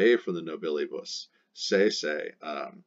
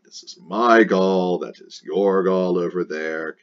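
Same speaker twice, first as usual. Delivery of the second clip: loud, slow, territorial chieftain claiming his land; possessive Ariovistus; 7 dB louder than usual. Acted. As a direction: stake out your turf like a proud warlord. Voicing Ariovistus claiming Gaul.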